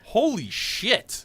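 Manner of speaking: slow amazed exclamation; surprised. Real, not acted. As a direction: gasp it out in awe. Reacts to the cheese.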